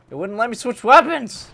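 loud, high frustrated yell at the game; furious shout. Real, not acted. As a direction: blow up at the broken mouse. Weapon switch fails mid-fight.

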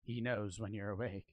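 low, soft, ominous mom imitation; dead serious. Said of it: "in a dead ser".

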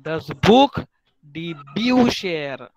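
slow, careful German: das Buch, die Bücher; teacher modelling German words; model pronunciation. Shown as a demonstration. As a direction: spell out Buch with kh and Bücher with sh, slowly. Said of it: "teacher models German plural".

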